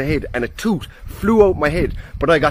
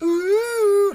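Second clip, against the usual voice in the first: high, knowing mock ooh; a sly teasing ooh. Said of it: "and I was like".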